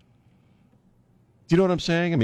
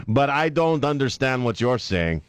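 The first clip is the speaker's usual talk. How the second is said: slow, higher-pitched fake Spanish accent; mock Spanish accent; 6 semitones higher, slower than usual. Host as her dodging Spanish-speaking reporters, mock Spanish accent. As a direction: stall in a hammy Spanish accent. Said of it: "speak to her in Spanish and see how she does".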